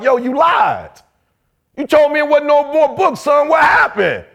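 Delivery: father yelling at his son in the corner; angry confrontation. Retold or imitated. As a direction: call him out, furious. Grabs son, drops in corner.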